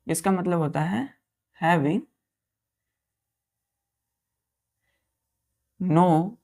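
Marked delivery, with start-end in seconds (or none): 1.6-6.4 slow, drawn-out dictation for writing. Shown as a demonstration. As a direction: dictate each word slowly for the class. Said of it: "teacher dictating slowly". (धीमे-धीमे बोलकर लिखवाना)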